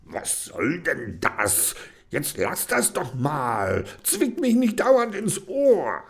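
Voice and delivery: slow, rising to high angry snap; snapping angrily. Acted. Reggie wakes Kenny angrily, snapping. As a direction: snap at her, getting angrier. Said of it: "schnauzte sie wütend an".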